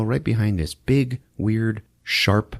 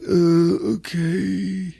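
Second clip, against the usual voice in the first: mock nervous; 8 semitones higher, much slower than usual. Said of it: "He's like".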